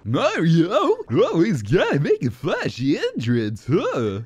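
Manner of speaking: loud, high cartoon henchman; high, loud put-on creature voice; minion, scornful. Voicing a game minion.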